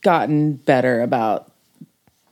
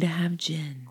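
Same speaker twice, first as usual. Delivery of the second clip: whispered; 10 dB quieter, slower than usual. Acted. Warning label joke.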